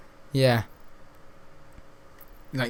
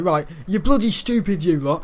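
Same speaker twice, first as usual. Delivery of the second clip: raised, louder mock telling-off; imitated angry teacher; 4 semitones higher, 6 dB louder than usual. PE teacher shouting at the class in dodgeball, imitated anger. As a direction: act out a teacher losing his temper. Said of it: "he was getting all angry".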